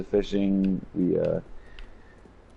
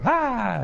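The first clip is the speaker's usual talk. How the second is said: high playful scream, echoing; a toddler's happy shriek, retold; imitated toddler scream. Dad imitating his daughter's slide scream, high playful shriek. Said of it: "And then she'd go".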